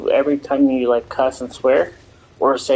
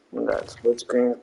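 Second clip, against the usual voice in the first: ordinary talk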